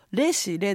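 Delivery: "レシレ" with plain Japanese syllables; romaji misreading. Shown as a demonstration. Co-host shows the mistake, Japanese-style romaji reading. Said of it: "ローマ字読みをすると".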